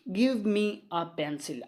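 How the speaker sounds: each word read slowly and evenly; careful classroom dictation. Shown as a demonstration. Teacher dictates example sentence.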